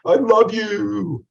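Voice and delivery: drawn-out, high, loud I love you; gushing love declaration. Shown as a demonstration. Teacher showing students how delivery changes words, gushing. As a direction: croon it slowly with exaggerated feeling. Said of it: "there's a huge difference".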